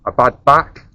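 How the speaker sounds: low, loud movie-trailer announcer; dramatic trailer voice. Acted. Dramatic intro to the video.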